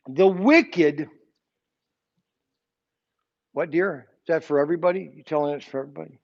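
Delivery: ordinary delivery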